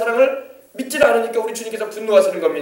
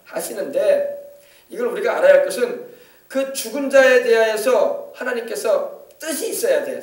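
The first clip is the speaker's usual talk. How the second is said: normal talking voice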